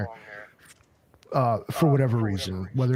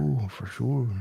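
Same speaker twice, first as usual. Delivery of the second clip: put-on Michigan accent; 4 dB quieter, slower than usual. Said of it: "Every O is two O's".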